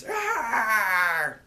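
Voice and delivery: high, loud mock scream of "arr". Ice King leaping off bed, loud mock scream. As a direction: let out a loud hammed-up scream. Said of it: "jumps off the bed and screams".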